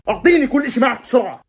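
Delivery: hammed-up threatening order; mock robber demand.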